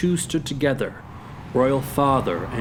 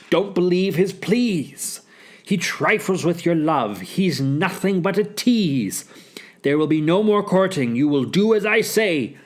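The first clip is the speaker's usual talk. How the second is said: higher, emphatic scolding; scornful snort; 5 semitones higher than usual. Acted. Polonius forbidding his daughter's courting, scornful. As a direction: lay down the law, dismissively. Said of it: "Polonius snorted".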